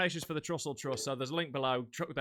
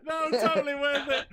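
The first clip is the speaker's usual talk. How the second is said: high, laughing through the words; 9 semitones higher, 4 dB louder than usual. Real, not acted. Host after a laughing fit, high and laughing. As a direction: squeeze it out through real laughter.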